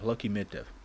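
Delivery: ordinary talk, nothing marked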